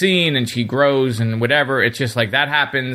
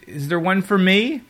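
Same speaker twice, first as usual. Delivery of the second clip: higher, hopeful little Perry voice; mock Perry; 7 semitones higher than usual. Podcaster mimics Perry asking about her monument, higher and hopeful. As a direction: play her up as needy and hopeful. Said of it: "you hear her say".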